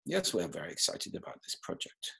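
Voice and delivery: low, soft, restrained monotone; restrained, underpowered delivery; bored Scandinavian prosody. Shown as a demonstration. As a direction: sound bored while claiming excitement. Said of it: "It tends to sound a little bored".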